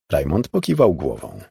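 ordinary delivery

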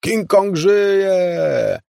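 high, loud, very drawn-out yell. A narrator's reading. Jim hitting the gas, long high yell. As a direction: stretch it out like a triumphant roar. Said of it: "wrzasnął Jim".

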